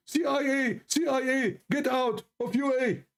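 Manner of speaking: high-pitched mock crowd chant; mock protest shouting. Retelling a street protest against the president, mock shouting. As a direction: imitate a street crowd shouting their slogan. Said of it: "they start shouting".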